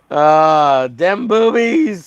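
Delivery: high, slow goofy voice; mock character voice. Quoting Draw Zombie in a goofy high voice. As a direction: stretch it out in a silly high voice. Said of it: "says Draw Zombie".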